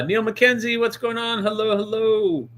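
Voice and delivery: bright, high chat greeting; cheery greeting. Real, not acted. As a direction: greet the viewer with a lift. Greeting chat viewer by name.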